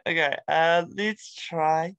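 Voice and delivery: ordinary delivery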